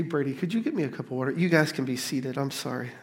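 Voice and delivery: ordinary talk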